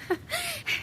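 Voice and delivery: a short, soft, high knowing laugh; a brief, hushed laugh; soft eerie chuckle; quiet laugh. Acted. Scoffing laugh before correcting her.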